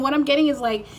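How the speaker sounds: usual speaking voice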